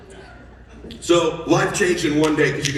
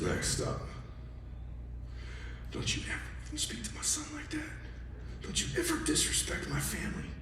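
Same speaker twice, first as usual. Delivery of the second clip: hushed, firm warning; retold stern scolding; 7 semitones higher, 16 dB quieter than usual. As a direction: lay down the law under his breath. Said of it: "And it was loud".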